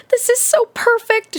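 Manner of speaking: high, delighted aunt voice; thrilled character line; gushing. A narrator's reading. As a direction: gush it in a high pitch. Aunt gushing over the friends.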